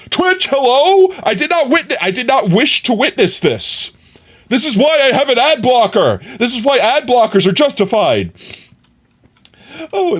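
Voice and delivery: high-pitched indignant protest; mock outrage. Streamer protesting to Twitch about an ad, mock outrage. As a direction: complain in hammed-up disbelief.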